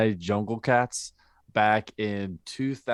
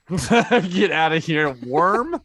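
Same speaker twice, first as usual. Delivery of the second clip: higher, louder, laughing get outta here; laughing disbelief; 8 semitones higher, 7 dB louder, much slower than usual. Real, not acted. Reacting to worm charming, laughing.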